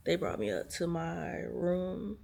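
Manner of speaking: normal talking voice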